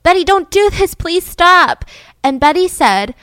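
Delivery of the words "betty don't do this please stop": high, loud acted plea; desperate pleading. Candy pleading as Betty grabs the axe, desperate. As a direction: beg her urgently to stop.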